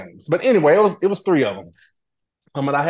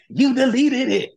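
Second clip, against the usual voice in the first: slow, higher mock-frustrated accusation; frustrated Candy; 10 semitones higher, 3 dB louder, slower than usual. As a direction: drag it out like an exasperated friend. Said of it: "Making that little, that face of frustration".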